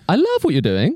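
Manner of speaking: "doing" swung high at the end; rising ending. Retold or imitated. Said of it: "You wouldn't go up at the end of that sentence".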